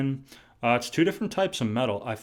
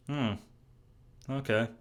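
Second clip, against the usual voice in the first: unimpressed hum and a muted okay; underwhelmed; 2 semitones lower, 7 dB quieter than usual. Acted. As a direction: let down, trying to be polite. Acts being let down by a city.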